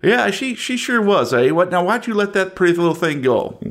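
leer at Aaron like a smug yokel; Southern yokel. Acted. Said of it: "I'm from the South".

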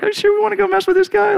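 very high, fast nervous squeak; hammed-up scared disciple; panicky objection. Disciples balk at possessed man.

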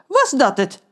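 John can't believe it's that easy; surprised. Acted. (verbaasd gevraagd)

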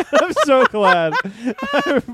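real helpless laughter through the words; laughing. Real, not acted. Relieved friend joking about the microphone accident, laughing. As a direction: talk while cracking up.

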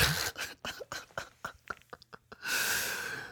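long, soft wheezing laugh; amused laugh. Real, not acted. Storyteller laughing at ketamine catfishing, amused laugh. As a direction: crack up quietly at your own joke.

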